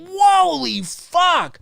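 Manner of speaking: slow, high stretched exclamation; acted blown-away viewer; awestruck reaction. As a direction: draw it out in amazement.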